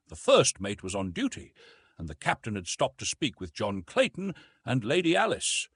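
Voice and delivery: usual speaking voice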